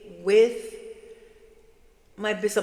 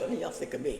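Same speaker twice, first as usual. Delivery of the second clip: ordinary talk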